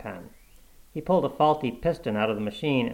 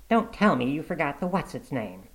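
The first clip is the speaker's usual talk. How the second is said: higher-pitched reproachful wife voice; nagging wife; 4 semitones higher than usual. A narrator's reading. Wife catching Mitty's forgetfulness, nagging. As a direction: scold him for forgetting.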